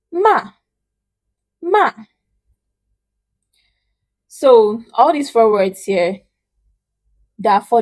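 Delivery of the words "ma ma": MA high, loud, dropping sharply; fourth tone. Shown as a demonstration. Teacher reviewing four tones, falling "ma". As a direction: make "ma" short, falling from high. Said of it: "let's have the four tones together".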